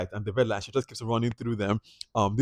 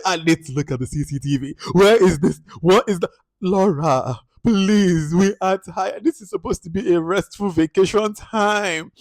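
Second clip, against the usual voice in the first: high, loud mock complaint; exasperated Laura; 8 semitones higher, 6 dB louder than usual. Guests complaining to staff about Laura, high exasperated mock whine. Voicing Laura demanding CCTV.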